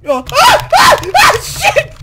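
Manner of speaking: very high, loud shrieks into a curse; piercing startled yelling; panicked scream. Real, not acted. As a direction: scream in bursts, then curse. Screaming as player falls.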